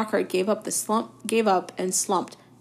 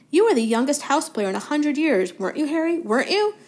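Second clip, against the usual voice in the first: put-on higher, louder, breathless boyish fan voice; eager young Colin; 7 semitones higher, 9 dB louder than usual. A narrator's reading. Colin bursts in to show Harry his photo, eager and high. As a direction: rush it out like an adoring kid.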